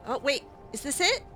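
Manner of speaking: usual speaking voice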